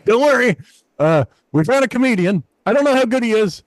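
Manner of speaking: high, doubtful showman intro; manager's introduction. Retold or imitated. Manager introducing the unknown comic, high and doubtful. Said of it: "And he goes".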